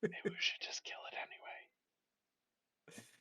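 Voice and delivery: light, hushed whisper; conspiratorial, under the breath; secretive whisper. Acted. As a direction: whisper a sneaky suggestion. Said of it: "I'm gonna whisper".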